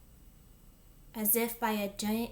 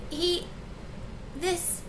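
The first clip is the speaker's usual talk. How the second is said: higher, halting fragments; falter, lost for words; speechless stammer; 8 semitones higher than usual. A narrator's reading. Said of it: "Old Golly was speechless".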